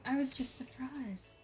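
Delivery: breathless whispered aside; startled whisper. Acted. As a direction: whisper it as if caught off guard. Voicing a startled game character.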